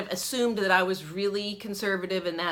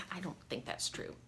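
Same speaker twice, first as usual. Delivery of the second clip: timid whisper; 14 dB quieter than usual. Retold or imitated. Meekly contradicting homeschool mom.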